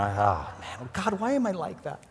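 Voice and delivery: soft, rueful self-reproach; regretful sigh to God. Retold or imitated. Preacher to God after yelling, soft regret. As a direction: groan at yourself quietly. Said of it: "You know".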